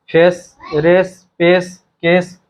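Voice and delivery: each word drawn out slowly; slow word list. Shown as a demonstration. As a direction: say the rhyming words one by one, slowly. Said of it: "एस आना चाहिए". (धीमे-धीमे शब्द बोलना)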